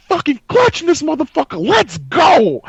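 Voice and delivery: high, loud clutch celebration scream; retold scream. Player screams while teammates laugh, triumphant scream. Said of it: "And then I scream".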